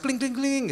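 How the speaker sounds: high chiming kling kling kling; mock phone chime; text message chime.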